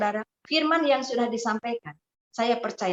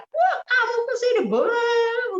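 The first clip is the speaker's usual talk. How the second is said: very high, strained yelling; husband's retold shout; 12 semitones higher, 3 dB louder than usual. Preacher imitates husband yelling at wife on arrival, retold shout. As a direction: yell it high like an enraged husband. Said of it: "suaminya langsung teriak".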